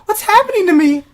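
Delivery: high, loud bewildered wail; mock crying panic. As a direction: play someone baffled by their own tears. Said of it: "just start crying".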